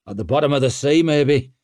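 loud grim grunt. A narrator's reading. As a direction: grunt it out grimly over the rain. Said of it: "grunted Jin".

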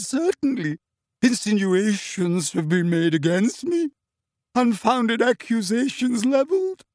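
high, slow, wavering and hurt; proud dignity cracking with tears; emotional, tearful seer. A narrator's reading. Trelawney insisting to Parvati, high and tremulous. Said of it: "in a voice throbbing with emotion".

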